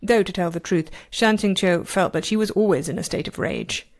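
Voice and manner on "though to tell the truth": plain reading voice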